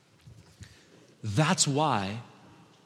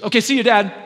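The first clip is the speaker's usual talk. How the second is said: high, loud goodbye to Dad; pitched-up, offhand kid voice; breezy daughter; 11 semitones higher, 12 dB louder than usual. Retold or imitated. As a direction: imitate a kid calling out a quick bye. Daughter's goodbye at school drop-off.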